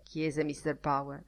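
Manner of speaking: ordinary delivery, nothing marked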